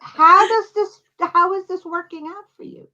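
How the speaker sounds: high, loud mock challenge with a laugh; mocking retort. Teasing New York audiences about their blockbuster. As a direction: needle them with a teasing jab. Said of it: "and say".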